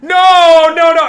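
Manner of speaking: hammed-up furious refusal; mock shout. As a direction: yell it like an outraged man. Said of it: "they're like".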